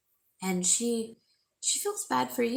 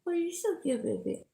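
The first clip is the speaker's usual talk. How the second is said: high, sing-song cooing to a pet; 10 semitones higher, faster than usual. Real, not acted. To her worried dog beside her, high cooing baby talk.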